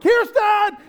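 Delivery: high, full-volume shout of a name; hammed-up panicked call for backup; freaking-out yell. Calling for Kirsten mid-meltdown, high frantic yell. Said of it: "And I'm freaking out".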